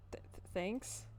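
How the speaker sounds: usual speaking voice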